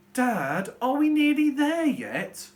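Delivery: high, loud, slow whine; a child whining from the back seat. Shown as a demonstration. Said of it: "That sounds much more like whining".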